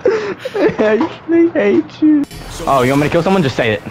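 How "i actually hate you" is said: exasperated wail. Real, not acted. Overwrought at teammate, half laughing.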